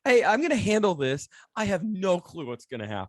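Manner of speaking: mock bravado. Mimicking friend's bravado.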